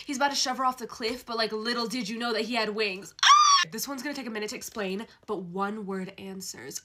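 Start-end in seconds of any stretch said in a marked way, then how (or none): 3.2-3.7 high shrill scream; shriek of delight. Real, not acted. Fan discovering hero has wings, squeal. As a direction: scream with glee.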